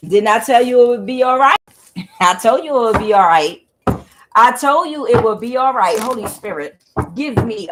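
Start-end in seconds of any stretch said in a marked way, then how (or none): 0.1-6.0 loud, laughing told-you-so; a loud, gloating I-told-you-so; triumphant declaration. Real, not acted. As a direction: proclaim it in victory. Triumphant after the singing.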